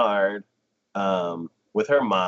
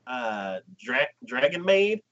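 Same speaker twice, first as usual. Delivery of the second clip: ordinary talk, nothing marked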